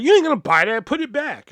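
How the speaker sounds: loud, high bossy order; mock bossy snap. Boss ordering the clerk, loud and high. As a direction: bark it like a rude boss.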